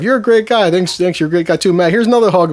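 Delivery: high, effusive party buddy; outgoing friendly guy. Retold or imitated. Matt praising his new friend Chris, high and effusive. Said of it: "Very friendly guy, very outspoken".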